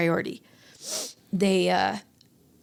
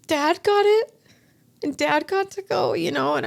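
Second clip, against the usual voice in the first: choked up; 9 semitones higher, 5 dB louder than usual. Real, not acted. Emotional over husband on chopper.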